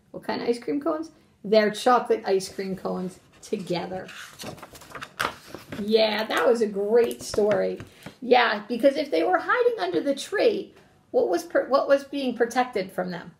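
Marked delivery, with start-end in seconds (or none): none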